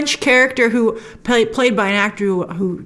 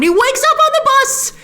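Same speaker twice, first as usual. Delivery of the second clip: excited high climax; 3 dB louder than usual. Real, not acted. Recapper telling the sudden twist after the scare, high and excited. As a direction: voice shooting way up in excitement.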